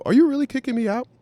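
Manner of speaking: high, loud disbelief; incredulous. Retold or imitated. Turning back to the manager, loud and incredulous. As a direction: call it back in shocked disbelief. Confronting manager while leaving.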